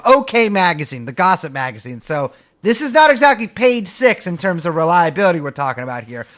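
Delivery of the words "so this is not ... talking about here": normal talking voice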